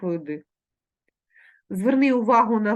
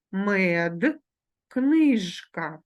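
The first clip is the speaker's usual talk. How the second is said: ordinary delivery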